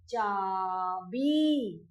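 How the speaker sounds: slow, stretched चाबी with a falling end; drawn-out demo word. Shown as a demonstration. Teacher models चाबी slowly for children, drawn out. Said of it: "तो क्या बोलेंगे इसको?".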